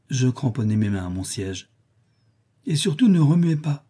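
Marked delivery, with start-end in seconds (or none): 2.7-3.9 a lightly voiced stern nurse, a bit higher; threatening servant. A narrator's reading. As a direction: warn the child firmly. Bessie warns Jane.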